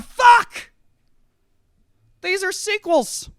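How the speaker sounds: stunned shout. Acted. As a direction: blurt out the revelation. Stoners discovering the books.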